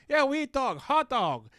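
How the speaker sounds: high mock accent, "hot dog" sounding like "whole dog"; mock Chinese accent. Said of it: "it sounded like he went like".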